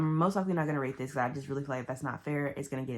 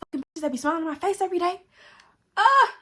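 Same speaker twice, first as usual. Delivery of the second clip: fast, high, loud screaming; furious scream; 8 dB louder than usual. Retold or imitated. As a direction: scream in fury and end on a yell. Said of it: "throw the phone on his face".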